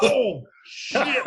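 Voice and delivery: high, loud shocked oath; drawn-out oh-no reaction; alarmed exclamation. Retold or imitated. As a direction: blurt it out in mock horror. Reacts to flooring the cop.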